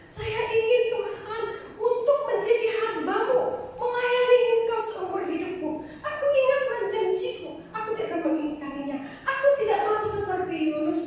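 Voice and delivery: high, tearful pleading to God; crying prayer. Retold or imitated. Girl praying to God by the river, tearful and pleading. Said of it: "nangis saya luar biasa".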